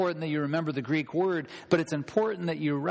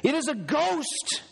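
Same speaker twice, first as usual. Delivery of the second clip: high-pitched cry of alarm; panicked disciple sighting something dreadful; terrified cry; 10 semitones higher than usual. Acted. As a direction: call out in acted terror. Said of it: "they were terrified and said".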